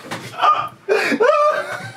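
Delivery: very high wheezing cackle; squeak, then a rattling run of laughs; helpless laughter. Real, not acted. Vlogger cracking up at the clip, wheezing with laughter. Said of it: "I can't stop laughing".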